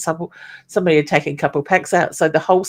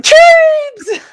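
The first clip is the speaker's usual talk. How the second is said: excited shout; 8 dB louder than usual. Real, not acted. Delighted at friend's snack.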